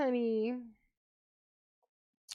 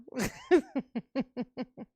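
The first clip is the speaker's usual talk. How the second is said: pulsing giggle that fades away; laughing. Real, not acted. Amused after doing the tomatoes, laughing.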